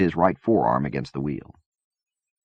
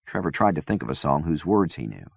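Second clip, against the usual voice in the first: usual speaking voice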